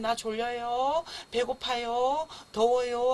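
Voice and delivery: put-on slow, drawn-out polite baby plea; baby's early mild complaint. Baby telling parents what it needs at first, mild complaint. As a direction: complain gently, stretching each word. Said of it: "요렇게 말하다가 십 초, 십오 초 지나면".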